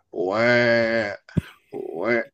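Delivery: low, emotionless mock "wah"; deadpan crying. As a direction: imitate crying with no feeling at all. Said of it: "I can see you cry".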